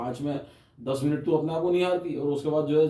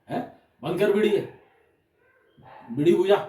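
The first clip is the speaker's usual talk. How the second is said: higher, louder command; mock angry order; 5 semitones higher, 4 dB louder than usual. Comic yell at the bidi smoker in the front row. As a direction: bark the order to put out the bidi.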